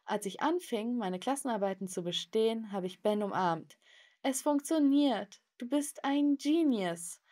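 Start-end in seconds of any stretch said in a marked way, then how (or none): 4.2-7.2 high, drawn-out excited praise; overjoyed. Acted. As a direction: gush slowly with delight, pitched up. Hugs tutor after passing tests. (begeistert und überglücklich)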